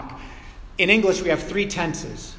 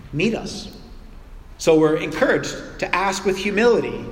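ordinary talk, nothing marked

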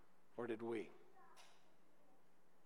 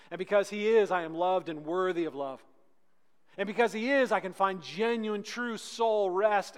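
building litany; 3 semitones higher, 7 dB louder than usual. Acted.

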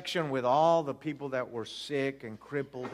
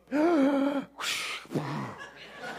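much higher startled gasp; loud shocked gasp; 11 semitones higher than usual. Retold or imitated. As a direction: gasp as the mother, half laughing. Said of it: "the lady goes".

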